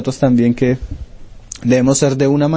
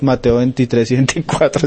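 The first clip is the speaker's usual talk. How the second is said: ordinary delivery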